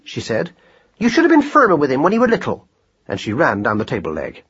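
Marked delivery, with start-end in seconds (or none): 1.0-2.7 high, fast telling-off; nagging wife. A narrator's reading. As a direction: rattle off the blame at him.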